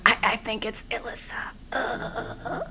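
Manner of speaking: faint unvoiced whisper, fading out; whispered dying words. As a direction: gasp out last words in a whisper. Eliminated player's dying words.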